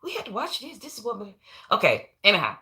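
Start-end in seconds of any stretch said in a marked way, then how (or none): 0.0-1.3 higher, softer gentle explaining; a hint of gently telling husband the truth; soft reply to husband. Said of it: "I said".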